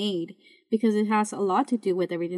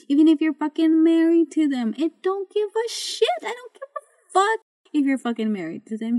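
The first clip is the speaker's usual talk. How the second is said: louder and higher, voice cracking with real anger; angry outburst; 8 semitones higher, 4 dB louder than usual. Real, not acted. Ranting at listeners who control their partners, cracking angry yell. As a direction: let it burst out, furious and breaking.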